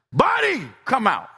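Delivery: high-pitched summons on the name; ringing name, then firm order; demonstrated call. Shown as a demonstration. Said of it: "Like this".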